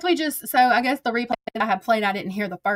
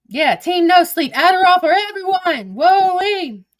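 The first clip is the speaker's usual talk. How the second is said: hyped; 6 semitones higher, 6 dB louder than usual. Real, not acted. Hyped on streaming all weekend.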